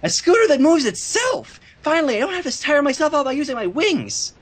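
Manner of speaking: a hint of a lazy excited filly; lightly voiced Scootaloo.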